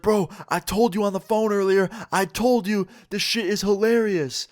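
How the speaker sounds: high-pitched, hyped-up friend retelling; mock hysterical excitement. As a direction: gush it high, as if cracking up. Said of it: "starts dying laughing".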